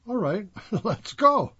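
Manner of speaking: a laughing, resigned all right; laughing give-in. Retold or imitated. Giving in to governor, laughing.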